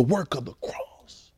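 hushed whisper, slow. Real, not acted. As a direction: drop to a slow whisper to draw them in. Preacher drops to a whisper.

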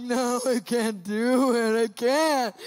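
groggy protest. Retold or imitated. Being woken, unable to get up.

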